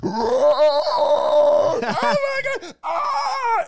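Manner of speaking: very loud high drawn-out scream; horror movie scream. Retold or imitated. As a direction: scream like the possessed girl on the menu loop. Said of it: "all you hear is".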